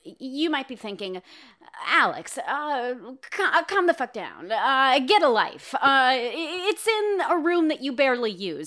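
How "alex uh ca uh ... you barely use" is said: high, loud whiny listener rant; mocking listener voice. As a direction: play an annoyed listener heckling. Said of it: "saying it like that".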